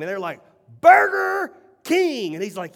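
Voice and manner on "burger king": high, slow, stretched-out shout of the name; loud shout. Retold or imitated. Executives shouting the name outside at the guru's request, loud and slow. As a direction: belt the brand name at full volume. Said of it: "Out loud, as loud as you can".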